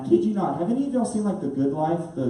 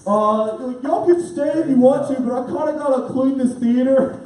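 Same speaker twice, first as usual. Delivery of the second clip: put-on awkward teenager; 6 semitones higher, 4 dB louder than usual. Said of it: "like, a teenager guy".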